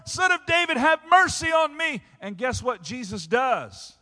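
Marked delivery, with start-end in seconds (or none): 0.0-2.0 desperate cry. Acted. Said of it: "She's crying out to the Lord".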